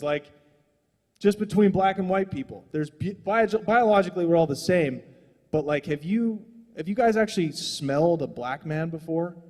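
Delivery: ordinary delivery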